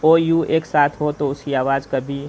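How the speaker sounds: ordinary delivery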